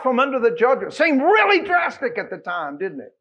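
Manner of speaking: loud, high, ringing proclamation; fervent revival preaching; preaching at full force. Acted. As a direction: build the prophecy into a shout.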